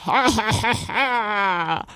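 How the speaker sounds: hearty, stagy "ha ha" laugh; giantess laugh. A narrator's reading. Giantess laughing off the knight's threat, mocking laugh. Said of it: "lachte die Riesin".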